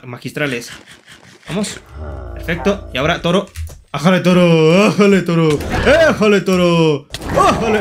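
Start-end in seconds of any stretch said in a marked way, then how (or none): 3.9-7.8 yelling at the bull. Pretend. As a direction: holler at a charging bull as it hits you. Yells while the bull hits him. (gritándole fuerte al toro)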